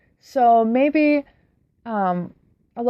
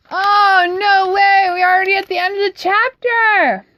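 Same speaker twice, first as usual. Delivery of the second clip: much higher and louder, real surprise; excited outburst; 10 semitones higher, 5 dB louder than usual. Real, not acted. The reader, surprised the chapter ended, cries out excitedly. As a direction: burst out that the chapter's already over.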